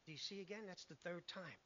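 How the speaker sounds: ordinary talk, nothing marked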